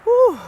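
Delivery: loud, high woo, still breathless; second whoop. Real, not acted. Hiker at the top, whooping again while catching breath. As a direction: shout it out, relieved. Recovering at hilltop.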